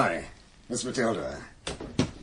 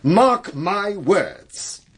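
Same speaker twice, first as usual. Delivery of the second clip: each word drawn out slowly, higher; stern warning; 5 semitones higher, 3 dB louder, much slower than usual. Acted. Clergyman warns Nancy over Bible reading, sternly emphatic. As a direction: pronounce it as a weighty, very slow warning.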